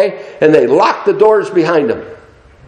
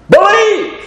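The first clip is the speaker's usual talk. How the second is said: very high, exclaimed order; imperative command. Acted. Preacher commanding the congregation to have faith, high cry. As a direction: cry out the single word as a command. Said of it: "That's an imperative command".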